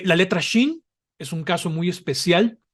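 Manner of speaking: ordinary talk, nothing marked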